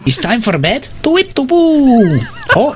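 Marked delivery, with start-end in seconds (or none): 1.0-2.2 owl hooting. Pretend. Man introducing his pet owl, high owl hooting. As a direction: hoot it long and high like an owl. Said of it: "It's my pet owl".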